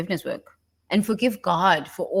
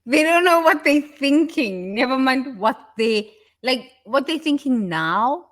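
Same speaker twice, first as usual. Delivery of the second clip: louder, higher, pressing hard; exasperated loud rant; 7 semitones higher, 6 dB louder than usual. Acted. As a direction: hammer it home, exasperated. Mocking people who don't know.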